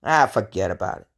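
put-on low, fast New York accent; New Yorker accent. Showing the New Yorker accent people hear, low and fast New York. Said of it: "say I sound like a New Yorker".

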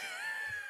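high squealing laugh; shrieking laugh. Real, not acted. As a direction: burst into a shrill shriek of laughter. Reaction to a fart.

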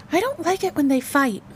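put-on high-pitched small child voice; complaining little girl. A narrator's reading. Little girl complains to her father.

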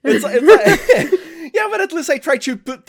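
giggly, bashful reply; laughing it off. Real, not acted. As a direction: laugh through the words.

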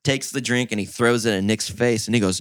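ordinary talk, nothing marked

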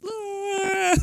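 high mock dog howl; hammed-up anguished dog cry; dog's wail. Dog hearing the cat got it, high mock wail. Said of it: "And then the dog goes".

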